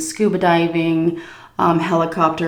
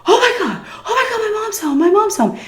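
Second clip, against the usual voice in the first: high-pitched panicked guy; freaking out like a scared teen. Retold or imitated. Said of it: "He's like".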